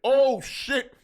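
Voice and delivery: a real jolt of amazement; surprised shout. Retold or imitated. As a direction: blurt it out, caught off guard. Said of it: "I said".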